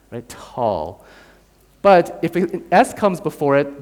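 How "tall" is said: "Tall", lower, with an airy T; aspirated T. Shown as a demonstration. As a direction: say "Tall" with a small H puff after the T. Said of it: "with a little H sound".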